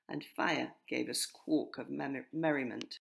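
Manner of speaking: usual speaking voice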